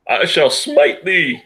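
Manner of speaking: slow, booming voice of God; mock biblical prophet. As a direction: smite them like an Old Testament God. I think that's the Lord.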